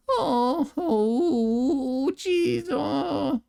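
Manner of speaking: very high, drawn-out silly squeal; sustained sky-high cartoonish whine; weird voice sample. Shown as a demonstration. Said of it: "That's not a weird voice".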